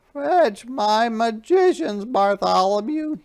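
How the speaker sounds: royal command. Acted. King Derwin ordering Bartholomew to fetch magicians, commanding. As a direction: boom it out like a frightened king still giving orders. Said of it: "he commanded".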